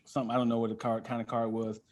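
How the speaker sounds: ordinary talk, nothing marked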